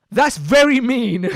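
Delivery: high, loud, drawn-out mock protest; wounded retort. Woman answering the man who insulted her, mock-wounded protest.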